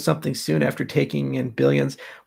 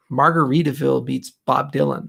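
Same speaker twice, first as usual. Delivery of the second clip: ordinary talk, nothing marked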